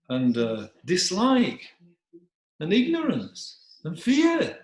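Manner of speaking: slow, emphatic denunciation, climbing high; rebuking dog. Acted. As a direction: lay out the charge word by word, pitch swelling.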